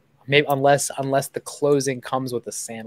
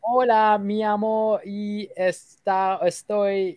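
put-on higher, halting Spanish accent sales pitch; 5 semitones higher, slower than usual. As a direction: fumble through a script in a Spanish accent. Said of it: "I can throw in an accent".

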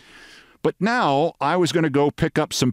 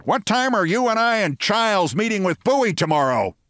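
high-pitched retold shout; Steinbrenner; 7 semitones higher than usual. Steinbrenner shouting to Williams about the meeting, high mock shout. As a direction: call it out high, without full volume. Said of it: "Steinbrenner shouted".

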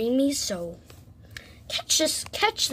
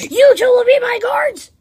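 high, loud royal command; hammed-up king ordering guards; commanding shout; 13 dB louder than usual.

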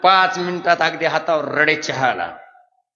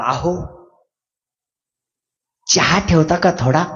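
polite city husband; 2 semitones lower than usual. Retold or imitated. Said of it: "मुंबईच्या माणसाचं कसं असतं".

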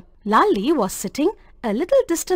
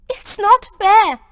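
high, loud protest about to cry; a tearful girl protesting injustice; 8 semitones higher, 5 dB louder than usual. A narrator's reading. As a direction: protest through welling tears. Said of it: "her big eyes filling".

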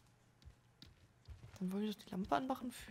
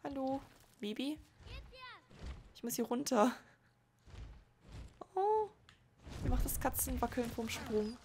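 higher sing-song praise for a pet; 4 semitones higher than usual. Real, not acted. Lobt das Tier wie ein Baby. (wie mit einem Baby sprechen)

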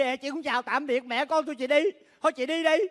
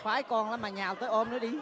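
teasing aside; 4 semitones lower, 10 dB quieter than usual. Acted. Sister mutters teasing aside.

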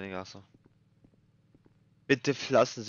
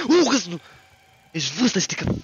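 high startled yell of fright; real scared scream, much higher and louder; jump-scare shriek; 9 semitones higher, 13 dB louder than usual. Real, not acted. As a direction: shriek as the killer appears.

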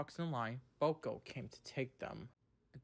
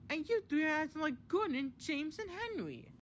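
very high-pitched scolding voice; Daisy, stern; 14 semitones higher, 3 dB louder than usual. A narrator's reading. Daisy compares the three engines to others, sternly. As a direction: scold them in a squeaky-high character voice. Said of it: "leaving a stern Daisy behind".

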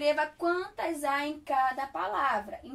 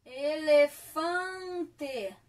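slow dictation; 4 dB louder, much slower than usual. Shown as a demonstration. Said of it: "teacher spelling word slowly".

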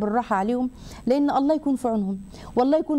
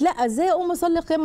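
quick, higher-pitched mock protest; protesting young bride; 7 semitones higher, faster than usual. New bride refusing to be seen praying, lightly mimicked protest. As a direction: lightly act out a bride's hurried objection.

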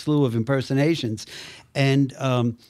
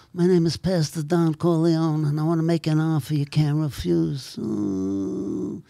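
Godfather impression; 2 semitones higher than usual. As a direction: play the old mob don. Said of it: "I do The Godfather".

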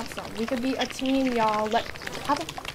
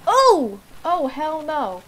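startled; 6 semitones higher, 5 dB louder, much slower than usual. Real, not acted. Startled by a growling hyena.

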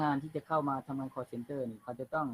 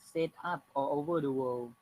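slow dictation reading; much slower than usual. Shown as a demonstration. Teacher reads text aloud.

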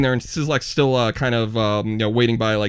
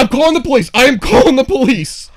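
higher-pitched, insistent retold threat; mock outraged threat; 6 semitones higher than usual. Owner threatens the mouse-dumper with police, mock outrage. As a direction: threaten him, hitting it harder the second time. Paul threatens during the screaming row.

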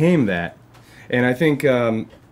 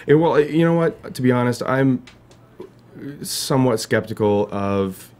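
ordinary delivery

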